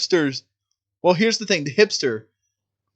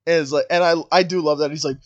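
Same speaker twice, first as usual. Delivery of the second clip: ordinary talk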